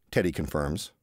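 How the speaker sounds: usual speaking voice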